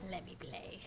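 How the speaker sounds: soft whisper; hushed plea. Acted. Streamer acting reluctant to talk on voice chat, whispered. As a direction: whisper it like a private little plea. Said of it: "I feel like I'm like".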